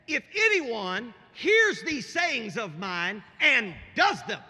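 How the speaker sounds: slow, loud, high preacher's delivery; forceful preaching. Real, not acted. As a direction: proclaim the scripture loudly, word by word. Preaches Jesus' words.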